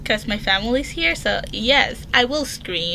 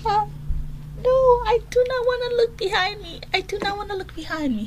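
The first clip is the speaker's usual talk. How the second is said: much higher, fast, tearful pleading; scared whimper; 10 semitones higher, 3 dB quieter, faster than usual. Real, not acted. Girl told someone stands behind her, frightened whimper. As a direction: beg not to look, near crying.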